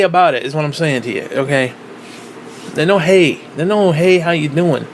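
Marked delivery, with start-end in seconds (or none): none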